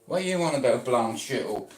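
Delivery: ordinary delivery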